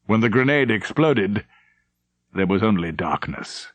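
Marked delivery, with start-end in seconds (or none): none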